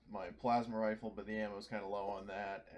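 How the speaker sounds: usual speaking voice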